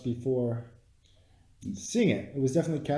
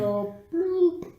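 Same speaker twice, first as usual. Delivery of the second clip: mock villain squeak; 5 dB louder than usual. Mocking villain taking stone.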